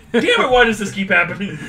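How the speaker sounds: high laughing complaint; laughing exasperation. Real, not acted. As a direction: laugh through the outrage.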